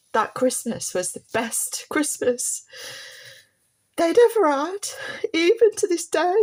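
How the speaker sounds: fighting back tears on a happy memory; choked up, pitch climbing into a crack; voice breaking. Real, not acted.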